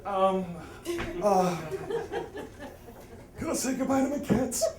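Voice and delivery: high, wobbly, near-sobbing ask; hammed-up weepy whimper; tearful plea. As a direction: beg like a big baby about to cry. Begging the cargo guy, tearful.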